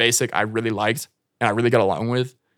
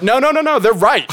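higher, louder put-on voice of a backing crowd; mock Russian dudes; 12 semitones higher, 7 dB louder than usual. Russian dudes backing the French girls, high and loud mock voice. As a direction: imitate the Russians siding with the girls. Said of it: "they're like".

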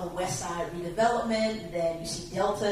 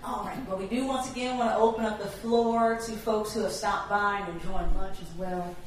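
ordinary delivery

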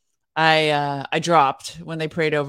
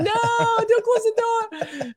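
put-on high, slow whiny kid plea; mock childish whine; goofy kid; much slower than usual. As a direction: beg like a goofy little kid. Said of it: "this goofy kid that was like".

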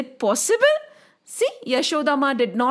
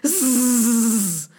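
long buzzing serpent hiss; snake hiss; 4 semitones lower than usual. A narrator's reading. Narrator imitates the tongue of the snake, hissing. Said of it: "the tongue of the snake went".

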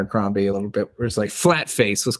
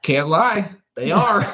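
higher-pitched comic comeback; playful retold reply; 6 semitones higher, slower than usual. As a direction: play the cheeky answer, lifting high on the end. Retold cheeky answer about teeth.

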